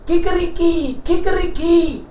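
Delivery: high crowing kikeriki; rooster crowing. Acted. The rooster crows high in the robbers' window. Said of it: "kräht der Hen".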